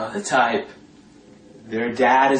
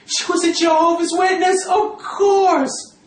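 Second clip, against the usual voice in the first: mocking exclamation. Real, not acted.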